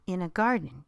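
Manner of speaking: ordinary delivery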